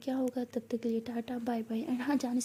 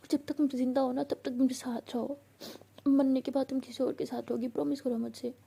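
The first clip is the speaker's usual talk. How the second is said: ordinary delivery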